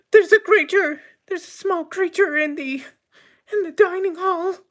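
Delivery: very high falsetto squeal; squeaky, frantic waitress, starting loud; panicked waitress. Acted. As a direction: squeal the alarm as a frightened waitress. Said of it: "I hear my waitress saying".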